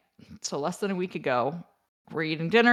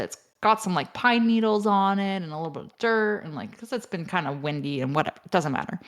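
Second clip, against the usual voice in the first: ordinary talk, nothing marked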